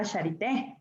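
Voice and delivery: Charité rising onto an accented final é; Charité stressed on é. Shown as a demonstration. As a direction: put the accent of Charité on the end. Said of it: "acento en la E para que sonara". (Charité con acento en la é)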